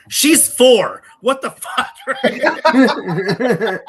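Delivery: high, loud, cracking up; laughing disbelief. Real, not acted. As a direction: exclaim through laughter at the absurdity. Laughing at his own coaching.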